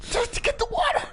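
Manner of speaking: put-on high, whimpering frightened old lady; scared grandma voice. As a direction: cry it out like a petrified granny. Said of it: "That's your imitation of a scared child".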